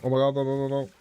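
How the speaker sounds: alarmed, rising no's; panicked cries. Real, not acted. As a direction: panic as the boss hits. Panicking at the boss fight.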